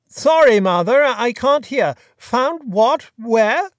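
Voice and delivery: calling down a bad line; loud, straining to hear. A narrator's reading.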